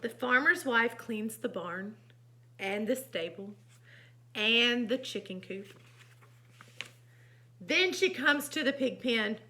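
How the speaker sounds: animated storybook reading; lively storytime voice; story narration. A narrator's reading. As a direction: read to children with big emphasis on key words. Reads picture book aloud.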